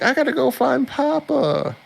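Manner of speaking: put-on very high, childish voice; little girl Mira. Retelling Mira's line in the anime, very high and childish. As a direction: sound like a small child. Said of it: "And Mira's like".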